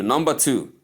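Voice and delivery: normal talking voice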